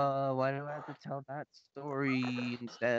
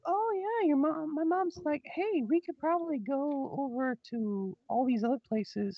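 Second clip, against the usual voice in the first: much higher, brighter mom voice; 14 semitones higher, 3 dB louder than usual. Retold or imitated. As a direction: chirp it like an excited mom. Mom quoted in story.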